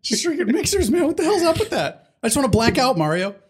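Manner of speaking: high, frantic Always Sunny whine; Charlie Day rant. Retold or imitated. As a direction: complain like a manic barfly. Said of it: "Always Sunny".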